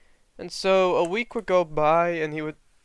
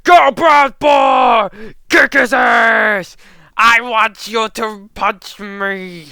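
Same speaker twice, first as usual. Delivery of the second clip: high, full-throated fight fan shout; bellowed cheering from a rowdy fight audience; roaring crowd cheer; 8 semitones higher, 10 dB louder, slower than usual. Acted. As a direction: cheer wildly from the crowd, drawing out the words. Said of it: "roaring, cheering, like".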